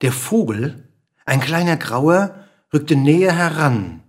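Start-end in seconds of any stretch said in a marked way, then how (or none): none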